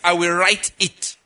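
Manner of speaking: "it" set apart and leaned on; stress on IT. Shown as a demonstration. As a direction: slowly land on the word "it". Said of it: "I emphasize the word it".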